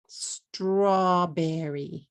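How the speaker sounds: "Strawberry" slowly, in three beats straw-be-rry; three-syllable English. Shown as a demonstration. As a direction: stretch "Strawberry" with str kept as one cluster. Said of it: "Three syllables".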